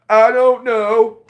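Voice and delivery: high, loud, slow whine; whiny kid. Retold or imitated. Sulky kid answering the parent, high drawn-out whine. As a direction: drag it out like a sulky child.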